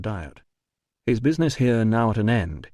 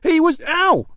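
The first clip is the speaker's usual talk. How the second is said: high loud cry cut off; yell, then yelp ow; yelp of pain; 7 dB louder than usual. Acted. Porter yelps in arm lock.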